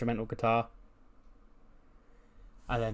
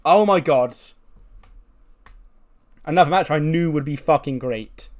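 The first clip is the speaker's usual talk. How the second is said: excited; 4 semitones higher, 8 dB louder than usual. Real, not acted. Reacting to a great match.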